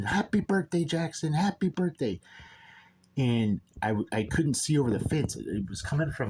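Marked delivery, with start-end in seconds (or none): 0.0-2.2 high-pitched mock scream; high holler, held back in volume; retold screaming. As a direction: imitate someone screaming a greeting, pitched way up.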